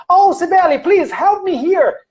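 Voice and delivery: high, imploring call to a sibling; pleading sister. Acted. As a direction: act out a sister begging a favor. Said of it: "you're talking to your sister. Your sister say".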